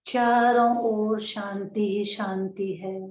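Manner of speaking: slow, drawn-out soothing meditation voice; guided meditation. Acted. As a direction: guide them gently into calm, stretching the words, long pauses. (धीमी, शांत ध्यान कराने वाली आवाज़)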